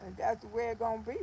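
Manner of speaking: normal talking voice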